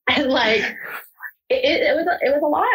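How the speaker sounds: emotional crack on the words; voice breaking. Real, not acted. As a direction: choke up as the memory hits. Overcome recalling meeting birth mom.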